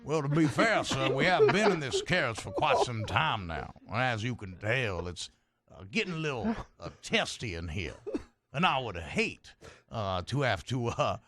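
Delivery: courteous, hesitant Southern negotiator; lightly played genteel Southern voice; Southern gentleman. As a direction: reason politely as a Southern gent.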